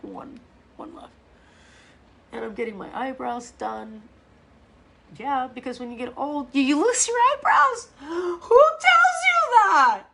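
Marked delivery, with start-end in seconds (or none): 0.0-1.1 a much softer, whispered admission. Real, not acted. Showing her missing eyelashes. 6.5-10.0 high, loud gasping outcry, slowing down; shocked outcry. Real, not acted. Outraged discovery about eyebrows.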